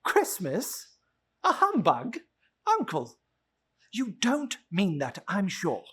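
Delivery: a hint of the cheerful nephew, amused disbelief; lightly voiced nephew. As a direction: gently tease the grumpy uncle. Nephew answers Scrooge.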